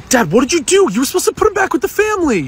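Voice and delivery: high, loud, fast shocked protest; shocked son shouting at dad; shocked outcry. Acted. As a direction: yell it in disbelief, fast and high.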